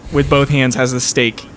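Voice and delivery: ordinary delivery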